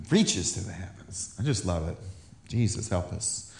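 low, soft reverent murmur; hushed awe. Real, not acted. Preacher moved by the verse, quietly awed prayer. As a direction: drop low and quiet, savouring it. Awed aside after reading scripture.

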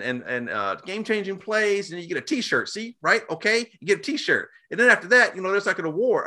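high mock pep-talk voice; mocking the coach. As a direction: parody a coach explaining a kiddie reward system. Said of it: "he's like".

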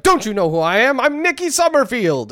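high-pitched, self-important outburst; indignant diva. Retold or imitated. Name-dropping singer to a rude demon hunter, indignant. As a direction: play up an offended celebrity. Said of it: "she constantly name drops herself".

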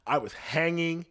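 ordinary delivery

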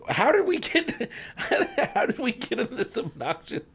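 high, slowed words squeezed through laughter; real wheezy laughing fit; helpless laughing. Real, not acted. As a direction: struggle to get it out while cracking up. Cracking up over their silly accent.

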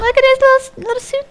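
cooing. Real, not acted. Cooing over a character's suit.

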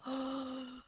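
sharp surprised gasp; a quick shocked intake of breath. Real, not acted. Reacts to missing meeting Igni.

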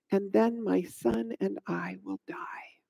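very soft, low, resigned; hushed despair. Acted. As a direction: drop almost to a whisper. Despairing end of widow's speech.

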